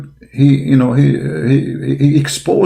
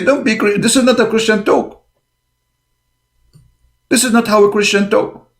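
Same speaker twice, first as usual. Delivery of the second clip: high, pinched imitation of offended critics; whiny mock outrage; 11 semitones higher than usual. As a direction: mimic their fake indignation. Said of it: "they say".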